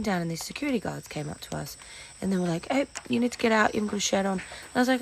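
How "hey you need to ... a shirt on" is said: louder stern guard order; guard telling off. Retold or imitated. Guard ordering a shirtless man out, stern and loud. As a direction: bark like a security guard.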